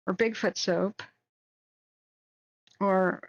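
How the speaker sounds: ordinary talk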